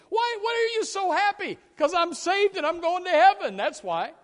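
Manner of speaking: high, loud happy cry of a saved man; joyful shout. Retold or imitated. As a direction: burst out with joy at being saved. Joyful believer voiced.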